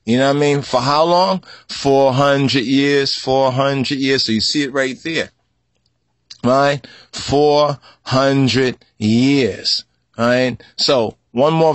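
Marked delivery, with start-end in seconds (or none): 1.7-4.2 slow emphasis. Real, not acted. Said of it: "emphatic repetition". 7.1-9.8 Four hundred years stretched very slowly; very slow emphasis. Real, not acted. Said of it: "emphatic repetition".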